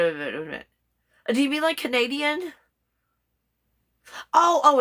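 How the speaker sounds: usual speaking voice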